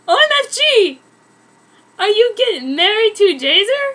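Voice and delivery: very high, loud disbelieving shriek; shocked squeal. Acted. Narrator shrieks at her sister's wedding news, shocked squeal.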